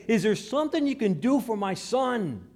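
loud, high fatherly appeal; desperate plea. Acted. Father asking Jesus to help his son, desperate plea. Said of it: "He's actually saying".

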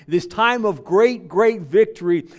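loud, ringing victory; preaching at full force. Real, not acted. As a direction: build it into a shout. Preaching climax.

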